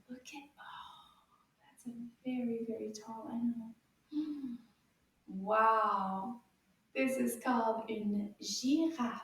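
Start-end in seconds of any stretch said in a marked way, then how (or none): 0.1-3.7 hushed, wondering whisper; whispered wonder at the giraffe; awed whisper. Acted. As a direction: marvel in a whisper. Awe at the tall animal.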